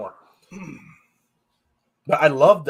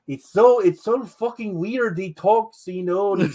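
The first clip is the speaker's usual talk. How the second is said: high-pitched mock German accent; amateur German accent, higher than your own voice; attempted German accent; 7 semitones higher, 4 dB louder than usual. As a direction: try a bad German accent, pitched well up. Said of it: "I can't do a German accent, but".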